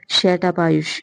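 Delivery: plain reading voice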